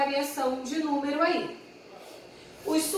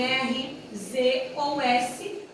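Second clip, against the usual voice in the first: slow spelling; much slower than usual. Shown as a demonstration. Teacher spelling out letters slowly.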